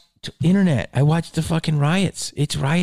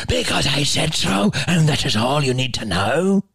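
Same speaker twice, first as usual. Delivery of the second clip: tyrannical nun. Retold or imitated. Nun shuts down pupils asking why, imperious mock scolding. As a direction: imitate a nun commanding like a ship's tyrant. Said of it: "Like fucking Captain Bligh".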